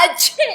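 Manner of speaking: high sneeze from a bad cold; high-pitched "aa-chhee"; acted sneeze. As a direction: break off into a big sneeze. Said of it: "मेरे जुकाम घना ही जोर मार रहा है".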